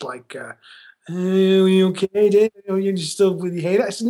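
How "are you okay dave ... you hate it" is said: slow, drawn-out mock skittishness; skittish imitation. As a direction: imitate someone nervously checking in, dragging out the words. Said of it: "they were very kind of skittish".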